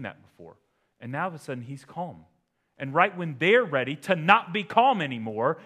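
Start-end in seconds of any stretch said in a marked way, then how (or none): 2.8-5.6 much louder, higher, building to a peak; preaching shout. Real, not acted. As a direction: hammer it home to the congregation.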